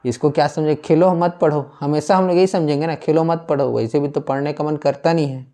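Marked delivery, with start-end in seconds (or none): 0.8-1.6 खेलो मत पढ़ो run together, pitched higher; one unbroken higher phrase from Khelo to पढ़ो; no pause. Shown as a demonstration. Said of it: "अगर ये ना रहे तो". 3.0-3.7 खेलो मत पढ़ो in one breath; खेलो मत पढ़ो as a single unbroken phrase; no pause. Shown as a demonstration. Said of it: "अगर ये ना रहे तो".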